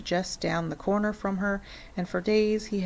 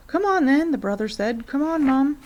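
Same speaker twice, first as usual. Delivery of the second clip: higher, louder eager brother; eager boy; 7 semitones higher, 5 dB louder than usual. A narrator's reading. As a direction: urge Mom to go look. Brother urging family.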